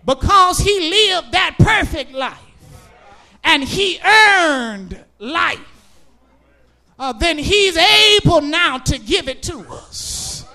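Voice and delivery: loud, rhythmic whooping preacher cadence; preaching at full force. Acted. Preacher to his congregation, preaching at full force. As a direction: drive each line home to the pews.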